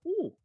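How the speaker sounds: high-pitched creature coo; tiny dragon's high "ooh"; Brenna. Acted. Pet dragon taking to a new friend, high coo. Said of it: "Brenna is very much like".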